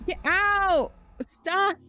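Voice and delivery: high, loud frantic yells; shouting at a slug in the kitchen; panicked shout. Real, not acted. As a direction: yell at it in panic. Shooing the slug.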